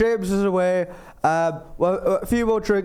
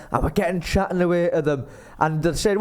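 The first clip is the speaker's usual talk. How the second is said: ordinary talk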